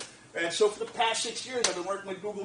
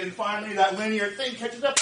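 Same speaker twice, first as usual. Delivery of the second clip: usual speaking voice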